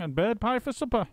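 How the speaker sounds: put-on high, sneering old woman; a nasty old wife's cackling voice; shrill Missus Twit. A narrator's reading. Missus Twit sneering to husband about the monkeys.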